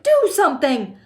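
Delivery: high, loud demand; screamed. A narrator's reading. Wife demanding husband act, screamed. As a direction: yell an urgent order. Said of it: "screamed at Mister Gloop".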